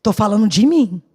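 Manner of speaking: ordinary talk, nothing marked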